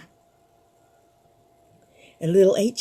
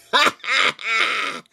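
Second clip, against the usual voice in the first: creepy laugh. Acted. Skeleton laughs again.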